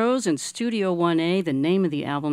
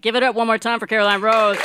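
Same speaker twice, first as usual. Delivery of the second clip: louder, higher rally to the crowd; calling out; 6 semitones higher, 8 dB louder than usual. Real, not acted. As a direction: pump up the studio audience. Host rallies studio audience applause.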